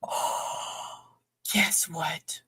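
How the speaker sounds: sudden gasp, then a breathy whisper. Real, not acted. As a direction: lean in and whisper the secret. Gasping to share a secret.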